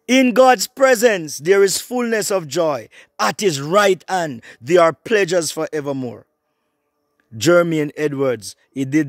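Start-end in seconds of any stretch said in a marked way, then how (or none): 0.1-6.3 high, loud declaration easing down; scripture proclamation. Real, not acted. Preacher declaring God's promise of joy to believers, exultant proclamation.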